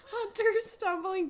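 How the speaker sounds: high, slow, breaking into laughter; laughing. Real, not acted. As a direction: read it while cracking up.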